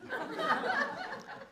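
high laugh. A narrator's reading. Happy laugh.

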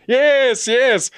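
very high, loud interjection; high-pitched, agitated contradiction; frantic insistence. A narrator's reading. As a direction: insist over him urgently. James contradicts colonel's gentle no.

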